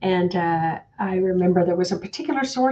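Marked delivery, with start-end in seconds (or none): none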